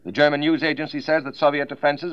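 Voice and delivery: 1940s radio news read; crisp wartime bulletin delivery; old newsreel. Acted.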